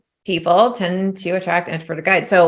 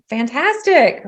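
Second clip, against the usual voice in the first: high, bright-on-top sarcastic "Fantastic"; mock enthusiasm; 7 semitones higher, slower than usual. Reacting to a silent guide, sarcastically pleased. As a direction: say it with hollow cheer, pitched up. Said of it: "I was like".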